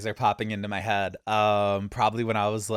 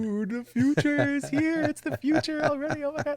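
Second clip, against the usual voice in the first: put-on much higher, breathless childlike amazement; awestruck kid; 3 dB louder than usual. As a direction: gasp it like a kid seeing magic. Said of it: "And I'm just like".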